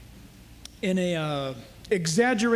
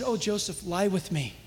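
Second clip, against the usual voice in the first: sultry plea; 4 semitones lower, 5 dB quieter than usual. Retold or imitated. Wife seducing Joseph.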